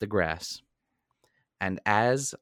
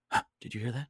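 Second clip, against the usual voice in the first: hushed worry; 2 semitones higher, 5 dB quieter, much faster than usual. Retold or imitated. 2:30am knock, Kyle waking him.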